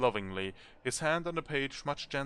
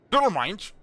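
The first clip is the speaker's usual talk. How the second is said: irritated retort; 8 semitones higher, 10 dB louder than usual. Acted. Annoyed artist voiced in story.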